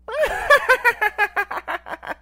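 high, loud villain cackle; maniacal laugh. Pretend. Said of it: "Do the laugh".

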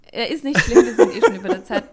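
loud, laughing reassurance. Real, not acted. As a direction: burst out laughing while reassuring. Laughing off co-host's toilet emergency.

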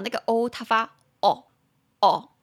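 o drawn out with rounded open lips; demo vowel o. Shown as a demonstration. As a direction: hold the o with an open rounded mouth. (嘴型圆开地拉长o音)

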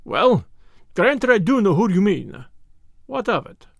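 mildly sullen, grudging admission; a touch of sulky defiance. A narrator's reading. Cornered Russian grudgingly admits he knows her, sullen. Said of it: "he said sullenly".

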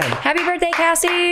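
bright, high birthday cheer; excited. Real, not acted.

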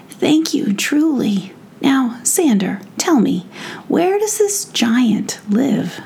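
a grateful prince; fairy prince voice. Acted. Prince thanks and asks.

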